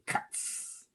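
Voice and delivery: Cuts whispered as a snare hit; breathy snare cut. Shown as a demonstration. Coach demos the beatbox snare, voiceless Cuts. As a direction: spit out Cuts with no voice, a sharp K. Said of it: "everyone say it with me".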